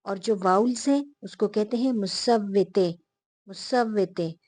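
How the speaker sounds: ordinary talk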